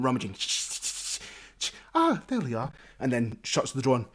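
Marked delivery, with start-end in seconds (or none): 1.9-2.7 higher, pleased landlady; innkeeper voice. Acted. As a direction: find it with a little satisfied ah. Innkeeper finds the key.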